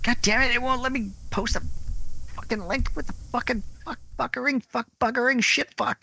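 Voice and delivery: high, exasperated swearing; frustrated cursing. Real, not acted. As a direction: curse at the broken link. Angry at the computer.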